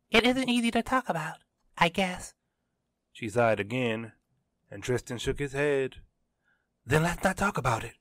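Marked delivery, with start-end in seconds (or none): 0.1-2.3 put-on high-pitched girl character in narration; Kiara's voice. A narrator's reading. Kiara admits her trouble to Tristan, high character voice. 6.8-7.9 quick, slightly raised blurt. A narrator's reading. He drops the awkward subject for her, blurted. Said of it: "he blurted".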